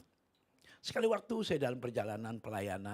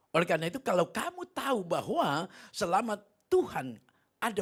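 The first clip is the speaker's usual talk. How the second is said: raised, emphatic exhortation; forceful preaching; 7 semitones higher, 7 dB louder than usual. Real, not acted. Preacher says God never leaves us, forceful high preaching. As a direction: press the assurance on the congregation.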